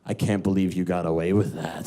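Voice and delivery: low, dry British imitation; unimpressed British man. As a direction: say it deep, as an incredulous Englishman. British man confronts him afterwards.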